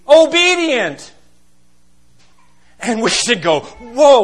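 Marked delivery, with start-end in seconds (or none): 0.1-1.1 obedient hit higher, louder and slow; drawn-out pulpit emphasis on obedient; emphatic. Acted. Driving the point home. 3.6-4.2 startled whoa. Pretend. Congregation's startled reaction.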